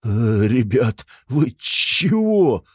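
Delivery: frightened stammer, high and slow; stammering in fear. A narrator's reading. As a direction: babble it out scared, stumbling. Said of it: "заикаясь от страха, пролепетал мужичок".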